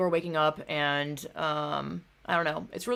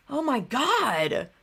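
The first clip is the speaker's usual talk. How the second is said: exasperated; 7 semitones higher, much slower than usual. Real, not acted. Exasperated after retelling the argument.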